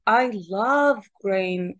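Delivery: "love" stretched out and emphasized; stress on LOVE. Shown as a demonstration. Teacher copying the woman in the dialogue, heavy stress on "love". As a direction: lean slowly on "love" to show the feeling. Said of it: "she stressed the word very much".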